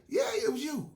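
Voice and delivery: high, loud insistence; insistent accusation. Acted. Friend insisting in the quarrel, loud and high. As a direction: nail him with it.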